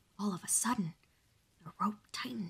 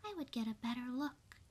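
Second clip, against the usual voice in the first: small mouse voice; 7 semitones higher than usual. Acted. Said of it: "she said".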